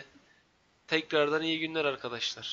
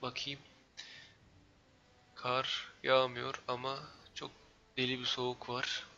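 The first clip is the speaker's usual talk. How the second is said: normal talking voice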